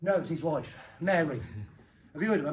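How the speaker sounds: ordinary talk, nothing marked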